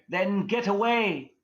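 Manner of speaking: high, drawn-out loud call; imitated spirit shout. Imitating a spirit arguing back on a recording, high commanding shout. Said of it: "And then you hear".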